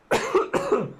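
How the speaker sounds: sharp high coughing fit; coughing up water. Real, not acted. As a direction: hack out the water. Water went down wrong pipe.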